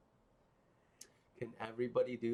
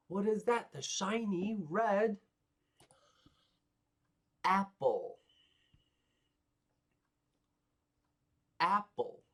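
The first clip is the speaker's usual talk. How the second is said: high, sing-song puppet voice; a cheery cartoon helper; high puppet asking the class; 9 semitones higher, 4 dB louder than usual.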